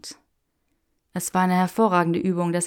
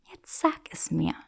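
soft unvoiced demand; whispered demand; 4 dB quieter than usual. Granger demanding the secret from Draco, whispered.

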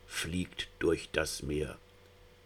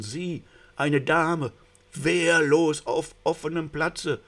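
higher and louder, breathless dread; rising panic; 10 semitones higher, 6 dB louder than usual. A narrator's reading. The narrator voicing the sea rose's panic as police crabs approach. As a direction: let the terror climb toward a shriek.

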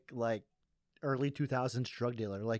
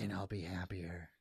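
whispered; 5 semitones lower, 8 dB quieter than usual. Real, not acted. Soft trailing aside.

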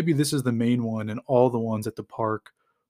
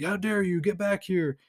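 higher, indignant calling after; mock outrage; 7 semitones higher than usual. Bald guy calling after the fleeing thief, outraged. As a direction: play the cheated bigot yelling after them.

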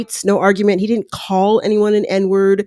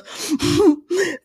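high mock chuckle copying Luke; a smirking little laugh; imitated smirk laugh; 8 semitones higher than usual. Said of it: "he kinda smiles like a smirk and goes".

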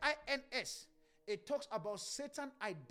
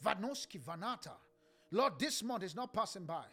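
pleading prayer; 6 dB louder than usual. Real, not acted. Softer pleading prayer, tongues.

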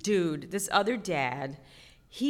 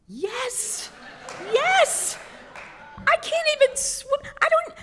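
much higher, louder frantic yelps; panicked shriek; 6 dB louder, slower than usual. Acted. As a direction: shriek it like you're drowning. Mock panic over class four rapids.

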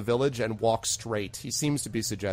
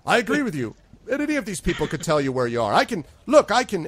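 eager bartender; 8 semitones higher, 6 dB louder than usual. Acted. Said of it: "He's like".

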